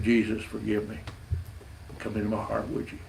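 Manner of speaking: softly voiced prayer, barely above a murmur; hushed prayer. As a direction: let the prayer sink to a hush. Man's tearful prayer retold.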